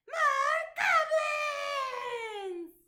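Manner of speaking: high, loud, very slow cry; cheer. Acted. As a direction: cry it out in delight. Goblins reveal themselves.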